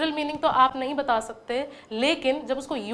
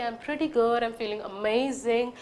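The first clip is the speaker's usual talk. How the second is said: slow demo; 2 semitones lower, much slower than usual. Shown as a demonstration. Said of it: "जब आप किसी को return बोलते हैं कि".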